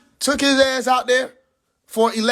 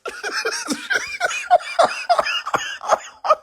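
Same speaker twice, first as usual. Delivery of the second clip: helpless cackle at the story; high wheezing laugh. Real, not acted. Big laugh.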